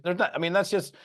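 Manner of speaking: ordinary talk, nothing marked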